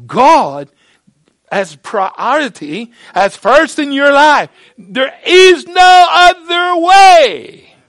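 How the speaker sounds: loud, high, slow cry; preacher's shout. Real, not acted. Preacher crying out to the congregation, loud and slow. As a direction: shout it to the back wall.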